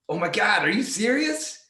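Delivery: wife thrilled, can't believe her husband's suggestion; excited disbelief. Retold or imitated. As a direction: act thrilled at the idea. Retelling wife's excited reply.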